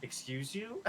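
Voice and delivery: indignant, offended excuse you; sassy excuse you. Retold or imitated. Friend's way with rude people, sassy indignation. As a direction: put them in their place. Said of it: "you're very like".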